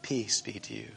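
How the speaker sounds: soft, hushed "Peace be to you"; gentle blessing. Acted. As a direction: lay "Peace" down gently and softly. Risen Jesus greets frightened disciples.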